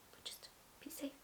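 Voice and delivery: whispered, breathy aside. Real, not acted. Advising viewers about dating, in a whisper. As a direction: whisper it like a secret.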